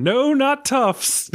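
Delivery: slow, high, emphatic "not Tufts"; drawn-out correction. Retold or imitated. Toofer insisting he didn't go to Tufts, slow and high. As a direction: stretch it out, snooty and firm.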